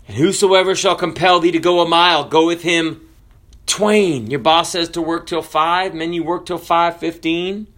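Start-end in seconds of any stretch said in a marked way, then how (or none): 0.1-4.3 louder, ringing scripture read; preaching scripture. Acted. Preacher proclaiming scripture to the congregation, full voice. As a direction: proclaim the verse to the crowd.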